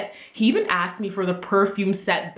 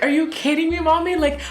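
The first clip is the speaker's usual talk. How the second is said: put-on much higher pleading kid voice; whiny teen pleading; 8 semitones higher, faster than usual. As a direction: beg your mother like a teenager.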